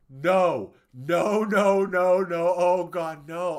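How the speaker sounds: loud, high string of protests; re-enacted wailing, easing off at the end; retold scream. Viewer begging the show to stop, screamed in dismay. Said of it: "I screamed".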